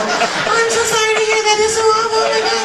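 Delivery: high, loud gushing apology; flustered lady. Retold or imitated. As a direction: gush apologies up high while backing away. Coworker retreating in apology.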